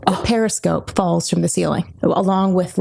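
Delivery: usual speaking voice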